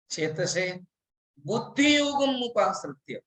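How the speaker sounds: usual speaking voice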